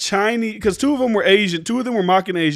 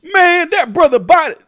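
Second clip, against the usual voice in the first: much higher and louder mock voice; 10 semitones higher, 7 dB louder than usual. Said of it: "you go".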